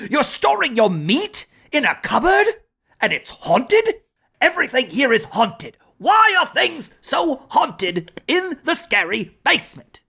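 high, ranting celebrity chef; Gordon Ramsay. Acted. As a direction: scold like a furious TV chef. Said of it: "do you want me to do a Gordon Ramsay for you".

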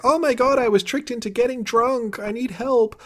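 high, hammed-up distressed voice, easing in volume; melodramatic character plea. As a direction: whine like a tipsy drama queen calling for rescue. Acts out Serena's phone call.

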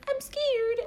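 put-on high, small frightened child; mock scared child; scared little kid. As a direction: squeak it like a scared little girl. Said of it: "I'd be like".

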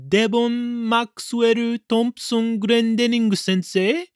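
put-on high, slow little-girl voice, prodding; tiny child's voice. A narrator's reading. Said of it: "A tiny voice prodded".

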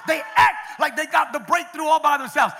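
loud, rising sermon cadence; preaching. Acted. Pastor urging the congregation to dedicate, full-force preaching. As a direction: drive the point home to the congregation.

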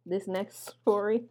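ordinary talk, nothing marked

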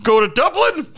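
high, loud Irish lilt; mock Irish. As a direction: play up the accidental Irish accent. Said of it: "Why is he Irish?".

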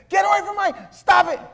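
imitated high-pitched, frantic shouting; screaming at them. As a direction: scream at attackers to back off, acting it out. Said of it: "And she's screaming at them".

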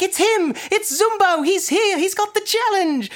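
high-pitched hyped-up Australian TV host impression; charismatic show hosts. Hosts hype the baker's entrance on his cooking show, hammed-up excitement. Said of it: "the hosts have all the charisma".

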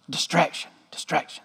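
a private reminder under the breath; whispered aside. Real, not acted. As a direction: whisper it to yourself. Self-reminder to stay on topic.

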